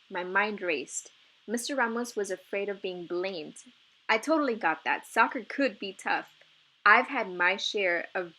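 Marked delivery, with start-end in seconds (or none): none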